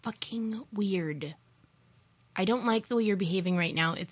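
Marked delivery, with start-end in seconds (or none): 0.0-1.3 slow, unvoiced whisper of disbelief. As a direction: whisper it slowly, weirded out. Suspicious wife at locked door.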